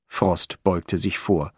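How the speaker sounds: ordinary delivery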